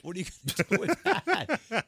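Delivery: loud, high-pitched disbelief; incredulous. Retold or imitated. Son questioning his mother's odd supplies, incredulous. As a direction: act out a son baffled, voice jumping up.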